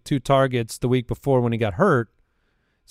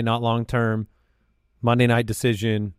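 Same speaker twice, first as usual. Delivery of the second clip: ordinary talk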